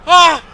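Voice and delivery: loud, high yelp of alarm; re-enacted startled yell. Caught squatting by the owner, acted startled yell. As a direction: cry out as if caught in the act. Retelling his startled reaction.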